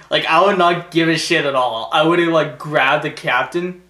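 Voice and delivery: usual speaking voice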